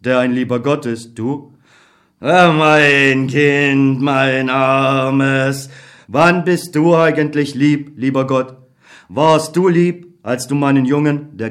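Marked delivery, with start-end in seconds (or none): 2.2-5.7 very slow, sighing "mein Kind"; drawn-out lament of a helpless old man; pitying old God. Acted. God pities the young soldier, very slowly and sadly.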